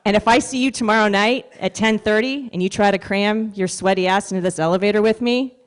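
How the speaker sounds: ordinary talk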